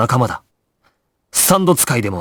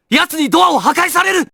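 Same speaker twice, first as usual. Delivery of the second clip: urgent shout. Acted. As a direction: yell the danger. Shouted warning about the door. (切迫した叫び)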